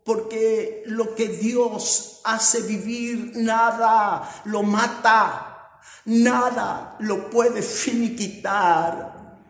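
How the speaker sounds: loud, slow, rising preacher's proclamation; preaching at full force. Acted. Preacher proclaiming God's power to the congregation, full force. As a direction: drive each phrase home to the church.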